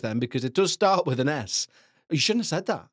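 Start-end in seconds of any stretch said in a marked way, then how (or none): 2.1-2.9 a put-on voice scolding himself; mock scolding. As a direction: tell yourself off in another voice.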